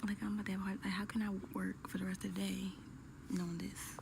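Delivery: hushed whisper so coworkers won't hear; excited news whispered at the office; whispering at work. Real, not acted. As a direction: keep it to a secret whisper at your desk. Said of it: "I'm whispering 'cause I'm at work".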